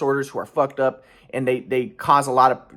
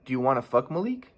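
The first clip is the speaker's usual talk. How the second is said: level, softer matter-of-fact asking; composed retelling of a blunt question; calm question; 6 dB quieter than usual. Retold or imitated. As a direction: ask it evenly, keeping the voice down. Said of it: "I just asked her calmly".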